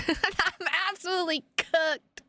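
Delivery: laughing through absolutely cooked. Real, not acted. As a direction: crack up as you repeat absolutely cooked.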